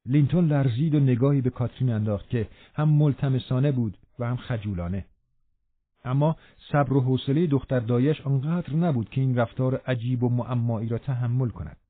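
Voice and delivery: usual speaking voice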